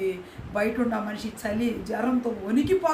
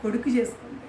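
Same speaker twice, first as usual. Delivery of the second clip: plain reading voice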